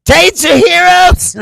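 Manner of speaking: high, drawn-out mock shout; mock fan cry. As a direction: holler it slowly like a lone fan. Acting out a lone fan's sign.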